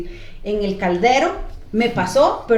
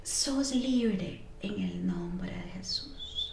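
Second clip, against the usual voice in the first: very slow, hushed proclamation; slow whisper; 3 semitones lower, 12 dB quieter, much slower than usual. Retold or imitated. The mother-in-law whispering she is free. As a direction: breathe it out as a blessing. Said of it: "Y decía".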